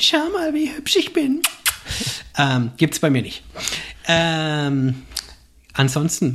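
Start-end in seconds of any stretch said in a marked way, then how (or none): 0.0-1.4 high, preening show-off voice; vain selfie poser. Retold or imitated. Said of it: "der Selfies macht".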